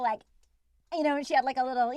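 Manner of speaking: normal talking voice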